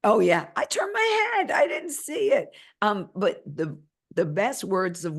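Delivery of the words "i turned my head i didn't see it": much higher mock voice, playful; high sing-song imitation of Teresa.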